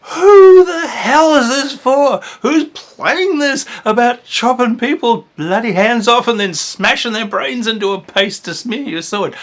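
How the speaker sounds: high, loud, slow shocked ranting; horrified disbelief at a gory game; alarmed outburst. Retold or imitated. Reviewer aghast at the gory artwork, shrill high outrage. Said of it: "I was like".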